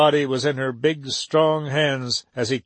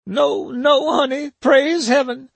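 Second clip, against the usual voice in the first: much higher, tearful laughing relief; between tears and laughter; 11 semitones higher than usual. A narrator's reading. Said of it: "somewhere between tears and laughter".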